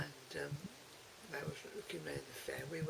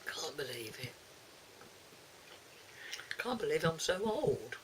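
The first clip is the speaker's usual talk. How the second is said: real disbelief. Real, not acted. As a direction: exclaim it in amused disbelief. Amazed at being great-grandmother.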